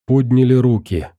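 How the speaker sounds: ordinary delivery, nothing marked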